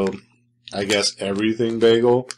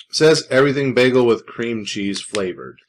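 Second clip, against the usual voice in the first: ordinary delivery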